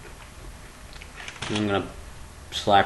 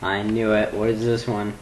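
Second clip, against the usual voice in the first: normal talking voice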